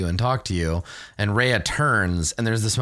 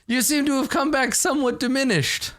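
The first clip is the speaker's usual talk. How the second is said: much higher voice breaking into laughter; laughing in character. Acted. GM's NPC teases Blackstar, laughing through it. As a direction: giggle through the courtly line.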